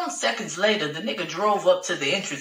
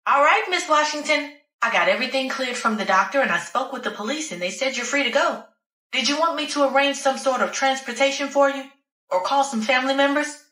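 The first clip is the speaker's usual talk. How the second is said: higher, businesslike nurse voice; 8 semitones higher than usual. Acted. Nurse telling a patient she's free to go, higher-pitched and helpful. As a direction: play an efficient nurse clearing a discharge.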